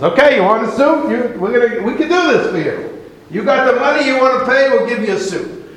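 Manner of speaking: high, bright salesman pitch; a tailor sweet-talking a rich customer; eager tailor. Acted. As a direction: tailor humoring a customer who has gold coins, eagerly.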